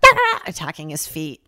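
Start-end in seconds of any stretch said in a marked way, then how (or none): 0.1-0.4 high yelping "Ah!" like a small dog; dog yelp imitation. Imitating the dog attacking the waiter's feet, high yelp. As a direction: yap it high and loud like a startled dog. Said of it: "she was like".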